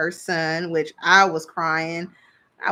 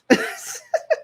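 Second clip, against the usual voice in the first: high airy laugh. Real, not acted. Laughing at own joke.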